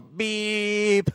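high beep noise; imitated beep. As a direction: squeak a keypad beep. Imitating button tone.

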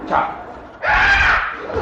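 sharp high shouted ciao; leaving in a huff, very high shout; shouted goodbye. Acted. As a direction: fling it back as you leave. Husband storms off.

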